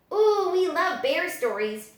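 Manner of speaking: put-on high child's voice, delighted; excited exclamation. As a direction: gush about bear stories. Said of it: "Dee exclaimed".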